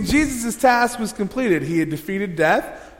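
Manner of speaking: usual speaking voice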